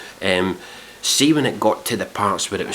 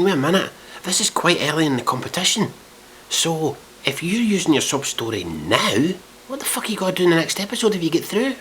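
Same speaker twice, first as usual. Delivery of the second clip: incredulous; 7 semitones higher than usual. Retold or imitated. Acting out his exasperated thought.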